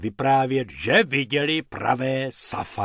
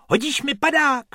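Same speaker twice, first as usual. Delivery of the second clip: loud-ish, high, eager request; gleeful high-pitched question; enthusiastic calling; 13 semitones higher, 3 dB louder than usual. A narrator's reading. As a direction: call out excitedly to your friend. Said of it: "volal Bobek nadšeně".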